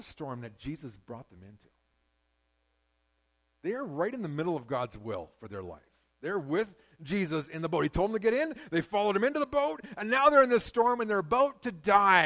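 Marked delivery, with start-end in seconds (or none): 6.2-12.3 preaching. Acted. Preaching the storm scene.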